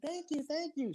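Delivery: high-pitched dainty woman's voice; imitating LaToya. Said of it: "She's like".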